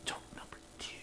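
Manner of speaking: whispered aside. Acted. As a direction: whisper the title like a secret. Whispered joke announcement.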